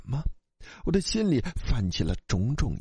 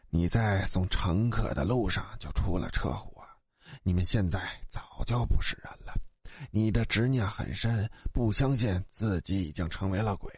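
quiet, deliberate underworld guide; solemn ghost guide; 7 semitones lower, 4 dB quieter than usual. Acted. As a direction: intone it like a spirit leading souls onward.

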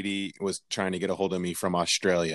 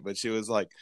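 usual speaking voice

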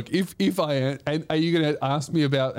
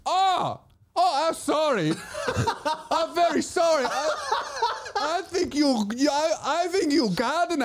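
very high, apologetic Chinese-accented imitation; high-pitched sorry in a Chinese accent; 14 semitones higher than usual. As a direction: mimic a flustered, apologising Chinese lady's accent. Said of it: "do the accent for that story".

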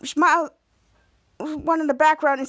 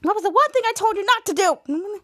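arguing; 6 semitones higher than usual. Retold or imitated. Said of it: "they're still arguing".

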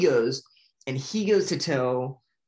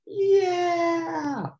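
much higher, louder whoop; cheering yell; 4 dB louder than usual. Retold or imitated. Fish in the tank celebrating their plan, high cheering whoop. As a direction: cheer like the fish just won.

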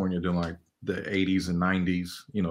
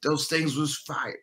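higher, excited voice of people remembering; hyped-up old partygoer; 8 semitones higher than usual. Retold or imitated. Old partygoers recalling the parties, hyped and higher. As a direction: gush about those parties like a fan. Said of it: "they talk about it".

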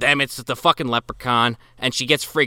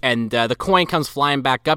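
ordinary delivery

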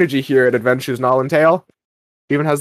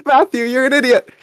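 high, exasperated know-it-all fan correcting him; a pedantic fan calling him an idiot, raised and exasperated; mock annoyed listener; 8 semitones higher than usual. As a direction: scold him like a smug listener. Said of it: "any of you listeners being like".

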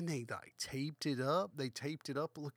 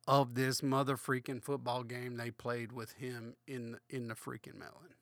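ordinary talk

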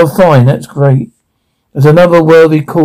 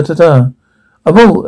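ordinary delivery

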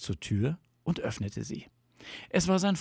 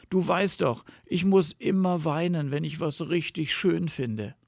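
higher-pitched emotional confession; moved bear; 7 semitones higher than usual. A narrator's reading. Tearful Bear explains himself to Fox, moved.

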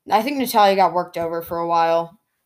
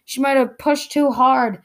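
Michael Cole; 6 semitones higher than usual. Retold or imitated. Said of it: "Michael Cole was like".

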